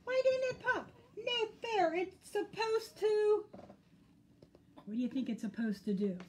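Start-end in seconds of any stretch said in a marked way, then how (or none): 0.0-3.4 very high, outraged whine; crying out. A narrator's reading. Skunk's balloon fails, high-pitched cry. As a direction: squeal the protest, stretching the end. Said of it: "cried Skunk".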